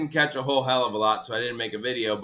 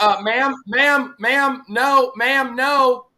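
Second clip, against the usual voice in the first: louder, higher urgent calls of ma'am; urgent warning; 10 semitones higher, 6 dB louder, much slower than usual. Retold or imitated. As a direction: stop her before she walks to the gator. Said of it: "And I'm like".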